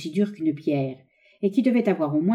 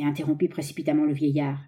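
usual speaking voice

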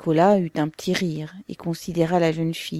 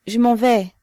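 louder, higher, decisive announcement; firm declaration; 5 semitones higher, 8 dB louder than usual. A narrator's reading. Saschenka announces she is leaving her comrades, declaring firmly. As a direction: raise the voice and declare it abruptly. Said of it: "déclara Saschenka".

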